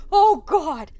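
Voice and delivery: very loud, high cry of dread; frightened outburst. Real, not acted. Player spooked by computer's reply, loud high frightened outburst. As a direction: blurt it out in fright.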